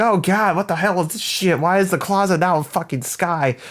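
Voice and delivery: high pitched panicked exclamation; mock freakout. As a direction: ham up the shock of falling into sky. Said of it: "and he's like".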